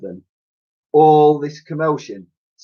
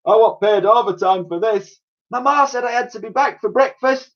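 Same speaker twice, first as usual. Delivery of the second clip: very high squeaky panicked cries; panicky policemen; 13 semitones higher than usual. Acted. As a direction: squeal like terrified bobbies. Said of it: "The officers all cried out".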